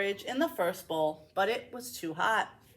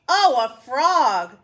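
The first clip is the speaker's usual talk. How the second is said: delighted; 3 semitones higher, 9 dB louder, much slower than usual. Acted. Princess delighted.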